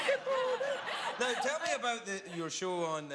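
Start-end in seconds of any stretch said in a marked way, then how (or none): 0.1-0.8 very high, squeaky take on Scottish; mock Scottish accent. Host echoes guest's Scottish greeting, in a very high mock accent. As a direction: put on a hammed-up Scottish lilt way up high. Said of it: "do your Scottish accent".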